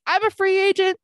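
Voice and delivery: telling friends she's jobless, high and breezy; carefree free agent. Retold or imitated. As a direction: toss it off lightly, high and airy.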